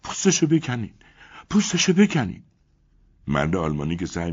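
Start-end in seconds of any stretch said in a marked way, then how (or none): 0.0-2.4 high, begging plea; pleading. A narrator's reading. Elena begging the German man, high and pleading. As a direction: beg him while tugging his coat.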